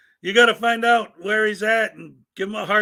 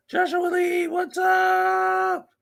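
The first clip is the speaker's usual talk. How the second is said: excited greeting; 12 semitones higher, much slower than usual. Real, not acted. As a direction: call out a big happy welcome. Greeting a viewer in chat.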